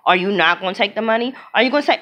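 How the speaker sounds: ordinary talk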